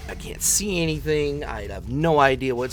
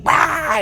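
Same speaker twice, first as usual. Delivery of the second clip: short, loud, high frustrated "Ah!"; mock monster roar; 8 dB louder than usual. Comic imitates friend stuck at the door, mock monster yell. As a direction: burst out like a monster freaking out. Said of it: "like Frankenstein on crack".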